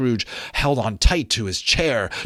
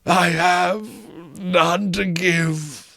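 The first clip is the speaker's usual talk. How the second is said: ghost lamenting; 7 semitones higher, much slower than usual. Acted. Marley's ghost explaining his doom, slow wailing ghost voice. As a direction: mourn it like a doomed spirit.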